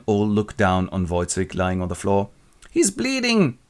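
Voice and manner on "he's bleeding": much higher remark; high onlooker. Retold or imitated. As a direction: gasp it as a bystander. Onlookers voiced.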